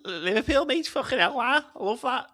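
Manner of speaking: high, loud teasing Scouse voice, "Liverpool, mate"; mock Scouse accent. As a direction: laugh while hamming up a Scouser. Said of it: "lovely Scottish Liverpudlian accent".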